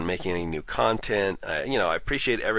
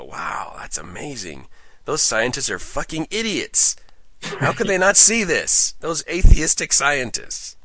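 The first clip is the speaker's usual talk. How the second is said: mocking believer; 7 semitones higher, 5 dB louder than usual. Mocking audience reaction.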